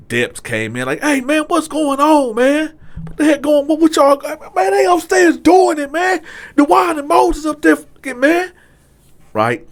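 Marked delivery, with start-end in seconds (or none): 1.0-8.5 high, loud rush of telling on them; excited yelling. Retold or imitated. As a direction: shout it all out at once. Inmates telling the deputies.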